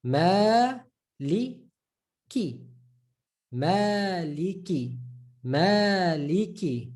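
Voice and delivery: "maliki" stretched slowly with a long ma; slow drawn recital. Shown as a demonstration. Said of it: "Like in Surah Fatiha".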